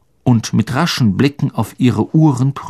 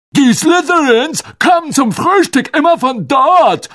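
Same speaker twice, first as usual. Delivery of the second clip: put-on disguised boy's voice; 5 dB louder than usual. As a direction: pitch it way up and push it loud. Ron answers in character voice.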